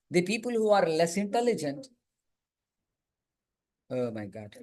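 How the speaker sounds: ordinary talk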